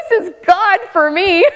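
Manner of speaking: high laughing, half crying; real joyful tears; laughing through tears. Real, not acted. Telling the congregation about answered prayer, laughing and teary.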